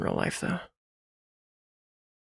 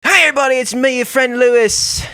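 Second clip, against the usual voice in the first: Lewis Blogs impression; 9 semitones higher, 4 dB louder than usual. To the viewers, as Lewis greeting his audience. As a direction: greet the viewers like a gaming host. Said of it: "do a Lewis Blogs gaming impression".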